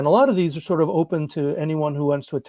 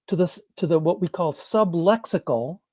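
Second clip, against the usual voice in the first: ordinary delivery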